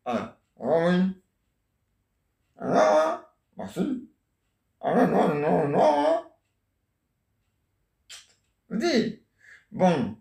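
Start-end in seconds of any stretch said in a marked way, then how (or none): 2.6-3.2 much higher put-on wife voice; grandma playing the wife on a pretend phone call, voice pitched way up. 8.7-9.2 much higher put-on wife voice; grandma playing the wife on a pretend phone call, voice pitched way up.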